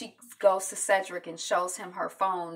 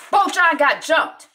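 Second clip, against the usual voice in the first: alarmed report; 9 semitones higher, 10 dB louder than usual. Retold or imitated. Junior reports alarming news.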